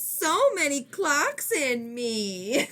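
high, drawn-out mock wail; mock outburst. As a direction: wail it slowly like a hammed-up child. Retelling the same screamed outburst.

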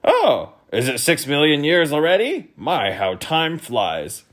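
pleased surprise; brightened. Acted. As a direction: perk up suddenly. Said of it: "brightened visibly".

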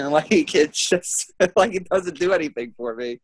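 usual speaking voice

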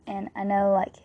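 ordinary talk, nothing marked

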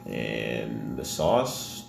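very slow, deep reveal; dramatic slow. Acted. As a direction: drag it out for suspense.

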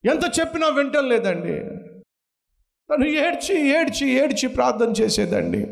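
high, fervent pulpit voice; impassioned preaching. Real, not acted. Preacher tells the congregation of the weeping mother, high fervent preaching. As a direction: preach the mother's grief with rising fervour.

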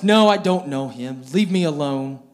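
a retold denial thrown out at full volume. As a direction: push him away with a loud No. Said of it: "He began to raise his voice".

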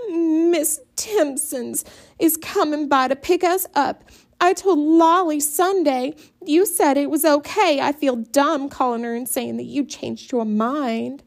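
high, near-tearful plea; pleading whine. A narrator's reading. Daughter begging Mama, high and near tears.

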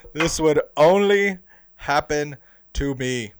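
a loud, slow cry of disbelief; exasperated. Retold or imitated. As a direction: wail it slowly to the sky, fed up. Hat just got thrown.

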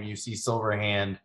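ordinary delivery

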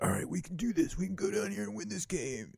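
mock pep talk. Quarterback trying to get the huddle pumped up, mock rallying. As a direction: imitate a huddle pep talk without full volume. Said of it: "trying to get 'em all pumped up".

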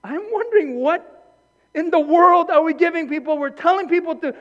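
rising to a passionate shout; fiery sermon building to a shout; preaching at full force. Real, not acted.

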